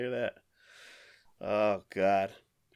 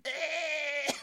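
mock retch; 14 semitones higher, 7 dB quieter than usual. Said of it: "I was like".

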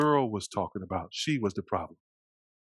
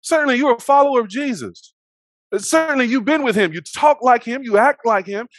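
high, loud rapid-fire accusations; pitched-up, insistent finger-pointing; mock accusation; 10 semitones higher, 7 dB louder than usual. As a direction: imitate accusers pointing someone out. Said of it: "They're like".

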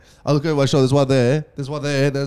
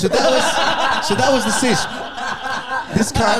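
much higher, laughing through the words; laughing disbelief; 11 semitones higher than usual. Real, not acted. Telling friends the story's twist, laughing high. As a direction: crack up at your own twist.

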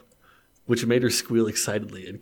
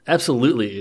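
loud eager reply; eager loud yes; excited; 3 semitones higher, 7 dB louder than usual. Acted. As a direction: jump at the offer loudly. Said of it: "Andy said excitedly".

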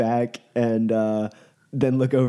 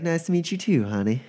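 higher-pitched sweet greeting, easing down on the last word; friendly Duchess; 7 semitones higher than usual. Acted. Duchess greets the nervous new mage, friendly. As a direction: greet the newcomer like an amused hostess. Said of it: "she smiles and reaches out".

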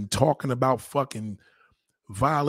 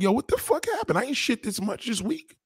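confused mock question; 8 semitones higher, 3 dB quieter than usual. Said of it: "They're like".

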